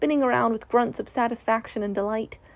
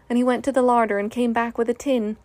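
ordinary delivery, nothing marked

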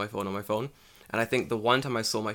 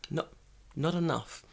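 usual speaking voice

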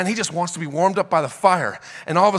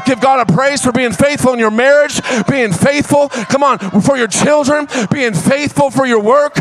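full-force preaching; 6 semitones higher, 3 dB louder than usual. Acted. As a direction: preach it out to the whole church.

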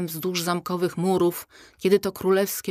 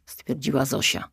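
ordinary delivery

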